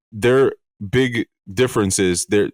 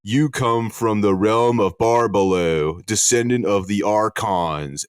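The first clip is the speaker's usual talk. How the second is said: solemn Judas; slower than usual. Retold or imitated. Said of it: "Judas supposedly stands up".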